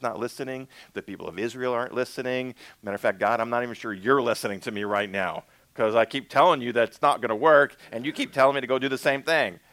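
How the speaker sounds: exasperated complaint. Acted. Moses complaining to God that it won't work, exasperated.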